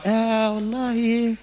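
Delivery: very slow, soft sigh; dreamy bride. Acted. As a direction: drift off dreamily. Bride answers judge.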